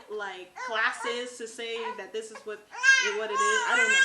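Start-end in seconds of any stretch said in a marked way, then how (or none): 3.2-4.1 emotional outburst. Retold or imitated. Recalling labor confusion.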